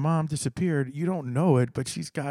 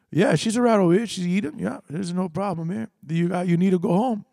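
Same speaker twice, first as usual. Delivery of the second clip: loud phone answer; 6 semitones higher, 4 dB louder than usual. Retold or imitated.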